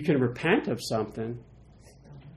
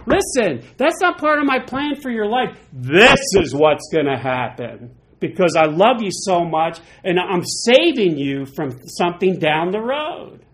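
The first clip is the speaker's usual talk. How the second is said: higher, loud, firm but loving; voice of God; 4 semitones higher, 7 dB louder than usual. Retold or imitated. God correcting a believer, firm loving voice. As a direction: correct the child gently but firmly. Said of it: "God's like".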